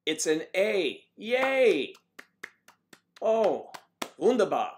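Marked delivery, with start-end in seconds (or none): 1.2-1.9 high, loud cheer; a little celebration shout; excited yay. Real, not acted. Celebrating a test result. 3.2-4.7 lightly put-on German wunderbar, loud; a playful stab at German; hammed-up German cheer; mock German accent. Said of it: "I cannot even do the accent".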